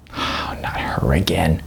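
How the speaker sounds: slow, breathy whisper after a sigh; weary whisper. Acted. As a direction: whisper it with a tired groan. Groaning at his wife's celebrity crush.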